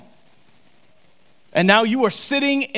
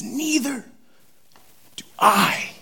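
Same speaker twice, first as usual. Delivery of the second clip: tender Jesus; 7 semitones higher, slower than usual. Retold or imitated. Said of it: "He says".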